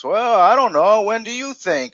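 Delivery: hesitant customer. Retold or imitated. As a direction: mimic a customer who can't decide. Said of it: "there's that dead air and this".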